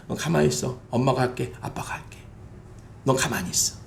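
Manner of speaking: hushed, whispered parent reassuring; calm the upset child quietly; soothing parent. Retold or imitated. Parent soothing a child who was bullied, hushed reassurance.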